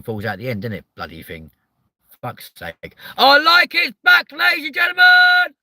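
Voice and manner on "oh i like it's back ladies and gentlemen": very high, loud showman yell; huge announcer shout. Pretend. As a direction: bellow it like a host.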